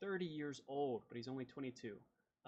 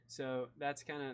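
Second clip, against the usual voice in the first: usual speaking voice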